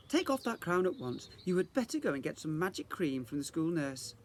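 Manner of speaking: teacher reprimanding a pupil, mildly cross; stern fairy teacher. Acted. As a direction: tell her off sharply. Teacher scolds ruined fairy ring.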